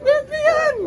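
high, loud startled cry of deadly; shocked yelp; excited exclaim. Real, not acted. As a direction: yelp at the steep drop. Exclaiming at steep drop.

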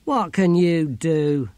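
dismissive sneer. A narrator's reading. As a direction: draw it out with contempt. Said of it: "sneered Ern".

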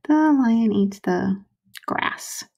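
slow, trailing into a whisper; drawn out, fading on grass; slow dictation. Shown as a demonstration. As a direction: say it slowly as you write it. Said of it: "I'm gonna put".

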